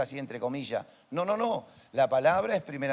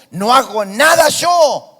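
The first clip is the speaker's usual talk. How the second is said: slow loud shout, higher; shouted quote; 6 semitones higher, 14 dB louder, slower than usual. Acted. Pastor quoting Jesus to the church, shouted. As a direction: proclaim it at full force.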